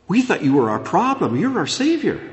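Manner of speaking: gasp, then rise high in amazement; acted wide-eyed discovery, climbing in pitch; astonished realization. People realizing Yeshua is their Savior, astonished.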